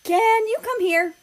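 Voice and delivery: high, loud call from downstairs. Acted. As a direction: shout up the stairs to him. Poland calls Germany from downstairs.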